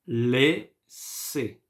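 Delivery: "Laissez" in two separate syllables, lé-sé; both endings as E. Shown as a demonstration. Teacher demonstrating spelling sounds, syllable by syllable. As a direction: split "Laissez" slowly, an é sound in each half. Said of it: "we pronounce it E".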